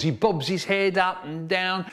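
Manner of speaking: ordinary talk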